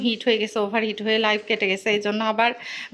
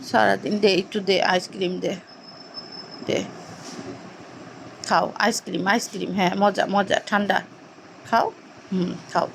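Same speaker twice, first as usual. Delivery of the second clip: coaxing softly; 4 semitones lower, 3 dB quieter than usual. Real, not acted. Feeding pet ice cream.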